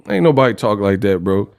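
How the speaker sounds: ordinary talk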